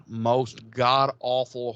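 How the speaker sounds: usual speaking voice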